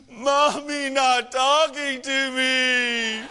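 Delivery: loud, high, drawn-out mock sobbing; hammed-up child's wail through sobs; sobbing little girl. Crying daughter complains to dad about mom, loud imitated wail. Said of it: "this was Bailey's response".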